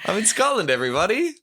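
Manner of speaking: high, hammy Scottish announcement; playful Scottish accent. Pretend. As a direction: boom it out in a mock Scottish brogue. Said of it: "pretty good Scottish accent".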